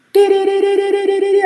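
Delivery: very high, piercing alarm tones; imitated alarm. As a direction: sing the alarm like a shrill siren. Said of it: "I heard".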